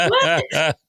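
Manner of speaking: a short incredulous "What?" through laughter; giggling what; laughing disbelief. Retold or imitated.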